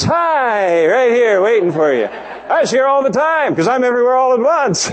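high, sing-out cheery greeting; playful voice of God. Acted. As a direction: greet the runaway from way up high, teasingly. Acts out the Lord greeting runaway.

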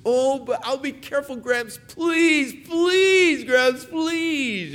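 begging granddaughter. Acted. Granddaughter begging her grandpa to let her, high pleading child voice. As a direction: beg like a child who wants her way.

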